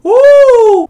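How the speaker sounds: sudden high, loud whoop of fright; startled shriek. Real, not acted. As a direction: let out a real scared, high "woo". Startled by eerie sound in video.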